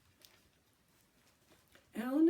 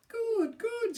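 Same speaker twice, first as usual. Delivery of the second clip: very high loud screech; 13 semitones higher, 6 dB louder than usual. Acted. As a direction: shriek it like a mad bird. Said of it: "screeched Billy".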